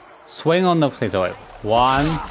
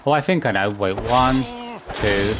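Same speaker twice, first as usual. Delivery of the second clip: usual speaking voice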